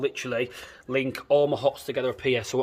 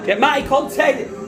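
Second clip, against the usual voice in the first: high and slow, coaxing; sing-song tease, drawn out; 10 semitones higher, slower than usual. Shown as a demonstration. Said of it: "Content, Matt".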